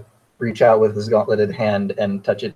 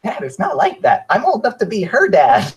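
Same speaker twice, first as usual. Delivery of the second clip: exasperated protest; 10 semitones higher, 4 dB louder than usual. Acted. Son denies romance to his dad, exasperated protest. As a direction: voice climbing very high in embarrassment.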